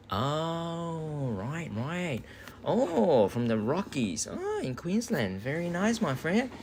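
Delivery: long drawn-out high "oh"s; impressed delight. Real, not acted. Streamer learns viewer lives in Queensland, high drawn-out approval. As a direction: swoop up high, pleasantly impressed.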